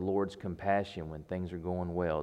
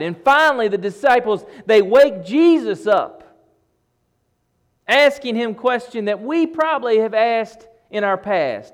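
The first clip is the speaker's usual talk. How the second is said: loud, raised storm storytelling; full-force preaching; 12 semitones higher, 12 dB louder than usual. Acted. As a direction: preach the mounting storm with urgency. Preacher dramatizes disciples' terror.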